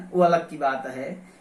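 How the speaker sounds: normal talking voice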